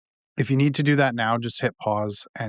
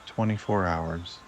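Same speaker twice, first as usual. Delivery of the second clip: unhurried, lower and quieter, easing them into relaxation; guided meditation; 3 semitones lower, 7 dB quieter than usual. Acted. Host guides listeners to relax before sleep, guided meditation.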